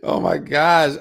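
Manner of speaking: loud exclamation just after a laugh; real, tickled oh-my-gosh; amused disbelief. Real, not acted. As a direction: react as if you just cracked up. Cracks up, then reacts.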